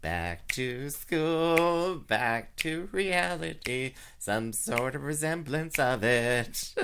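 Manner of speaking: slow, high, drawn-out sing-song; mock coworker. Coworker happy kids are back at school. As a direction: savor the words, teasing. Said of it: "She's like".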